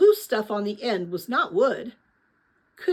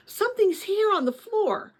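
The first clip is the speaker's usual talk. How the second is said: high, startled yelp of discovery; surprised yelp; 10 semitones higher than usual. A narrator's reading. Lena startled by something on the floor, yelping. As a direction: jump up in pitch, startled. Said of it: "gave a yelp of surprise".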